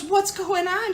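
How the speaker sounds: high, sweet, motherly coo; Stacy's sweet voice. Retold or imitated. Stacy comforting the crying wife, high and sweet. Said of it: "You know how Stacy is in that voice".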